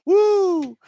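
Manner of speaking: high, loud whoop of laughter. Real, not acted. Streamer still cracking up, loud whoop. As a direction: let out a whoop.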